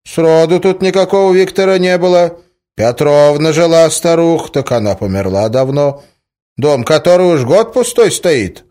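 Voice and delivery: put-on high, loud rustic old man; old villager. A narrator's reading. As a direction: insist like a village grandpa who knows everyone. Old villager answers, voiced by narrator.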